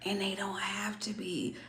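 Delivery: whispered aside; a hushed whispered aside. Real, not acted. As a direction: drop to a breathy whisper.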